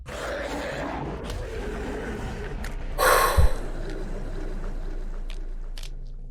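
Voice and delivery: slow, weary unvoiced sigh; long sigh. Real, not acted. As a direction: let out a long tired breath. Sighs at the scene.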